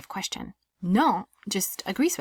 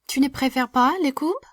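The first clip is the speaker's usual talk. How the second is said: "Tu ne préfères pas les Cubs" higher and louder, rising on "Cubs"; rising question intonation; 6 semitones higher, 6 dB louder than usual. Shown as a demonstration. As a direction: pitch it up and ask through "Cubs". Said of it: "make my voice go up at the end".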